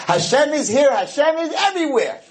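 high-pitched enthusiastic proclaiming; bright-eyed declaration, pitch climbing high; gleeful piety. Acted. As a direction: voice a good child's eager faith. Voices a good child's happy faith.

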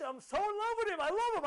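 high, loud gush of love; ecstatic devotion. Acted. Believer telling everyone of love for God, ecstatic. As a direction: burst out as if overcome with love.

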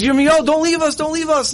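high-pitched imitated pleading; retold desperate begging; tearful plea. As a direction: plead as if about to cry, without real tears. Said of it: "And they started to cry".

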